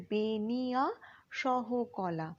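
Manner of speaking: slow, separated syllables in বেনিয়া সহকলা; drawn out syllable by syllable. Shown as a demonstration. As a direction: stretch বেনিয়া সহকলা for learners, part by part. Teacher sounds out the term.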